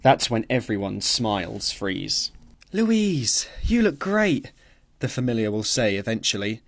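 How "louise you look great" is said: faux concern. A narrator's reading. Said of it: "in faux concern".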